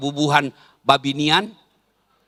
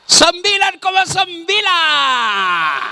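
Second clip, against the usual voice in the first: excited score; 11 semitones higher, much slower than usual. Acted. Preacher announcing the winning score, high and excited. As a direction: proclaim the score like a judge.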